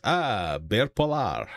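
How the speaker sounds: hammed-up French accent with a long drawn last word; mock French, stretched out for comic effect; bad French accent. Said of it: "He puts on the worst French accent".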